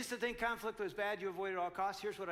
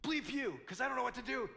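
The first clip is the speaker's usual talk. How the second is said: retold curse; 5 semitones higher than usual. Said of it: "I just, like".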